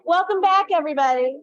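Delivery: loud, high, projecting to the room; coach's voice. Real, not acted. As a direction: call out like a coach over a crowd. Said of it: "so I'm using my coach's voice".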